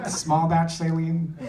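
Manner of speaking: ordinary talk, nothing marked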